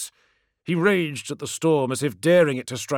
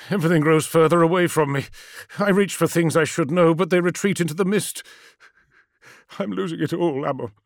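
higher, strained lament fading off; troubled confession of slipping away; despairing; 4 semitones higher, 4 dB louder than usual. Acted. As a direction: anguish sinking to helplessness. Magnus losing his memory.

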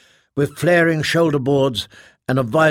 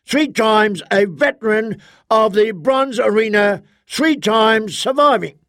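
booming arena announcer; 8 semitones higher than usual. Acted. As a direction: bellow the fighter's record to the crowd. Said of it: "The announcer began again".

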